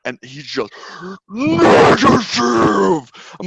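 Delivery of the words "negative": slow, high, loud furious bark; drill sergeant yell. Retold or imitated. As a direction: roar the word like an enraged sergeant. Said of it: "never seen a drill sergeant angrier".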